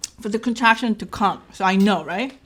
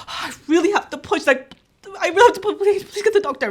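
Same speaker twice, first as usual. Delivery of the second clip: desperate begging; 6 semitones higher than usual. Retold or imitated. Begging husband for doctor.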